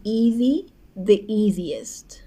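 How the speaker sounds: the easiest drawn out very slowly, every syllable clear; teacher modelling the easiest slowly; slow demo. Shown as a demonstration. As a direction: spell out the easiest slowly for the class. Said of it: "آخر واژهٔ ای اس تی".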